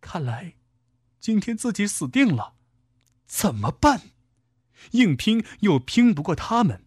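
high-pitched inner panic; frightened thought. A narrator's reading. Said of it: "少丽惊惧地想".

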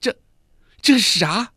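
wife terrified at the poison. A narrator's reading. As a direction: gasp it out in fear. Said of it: "惊恐万分地道".

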